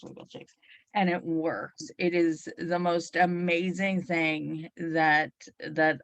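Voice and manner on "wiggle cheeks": whisper. Retold or imitated. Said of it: "walk past ... and go".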